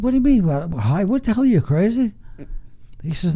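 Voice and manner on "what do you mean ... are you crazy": high, disbelieving questions; can't believe the plan; incredulous. Retold or imitated. Son to his father about hiding the tree, high and incredulous.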